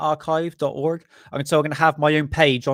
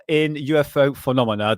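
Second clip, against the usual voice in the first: ordinary delivery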